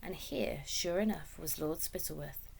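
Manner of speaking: usual speaking voice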